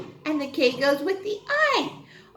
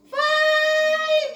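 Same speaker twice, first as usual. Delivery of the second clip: excited answer; 5 dB louder than usual. Acted. Teacher answers her own quiz question.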